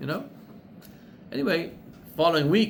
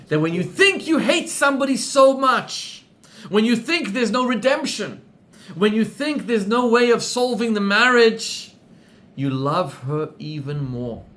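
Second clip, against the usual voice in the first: louder, higher sermon build; preaching; 5 semitones higher, 5 dB louder than usual. Acted. Rabbi preaches the story's moral to his class, loud and high. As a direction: drive the lesson home to the room.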